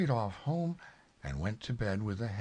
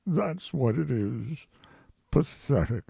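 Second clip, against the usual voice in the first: Eeyore; 6 semitones higher, 4 dB louder than usual. A narrator's reading. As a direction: pronounce it heavily, in self-pity. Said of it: "he said".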